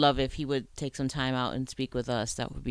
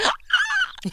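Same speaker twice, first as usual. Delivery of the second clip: giddy laugh; 4 dB louder than usual. Real, not acted. Giddy laugh after the hug.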